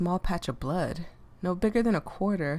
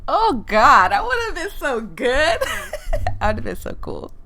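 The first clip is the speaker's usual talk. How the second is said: loud, high laughing gush; 8 semitones higher, 9 dB louder than usual. Real, not acted. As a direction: burst out laughing at yourself. Laughing at her own impression.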